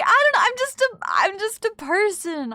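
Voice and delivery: high, loud embarrassed squeal; bashful high-pitched protest; flustered squeal. Real, not acted. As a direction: squeak it out, caught off guard by praise.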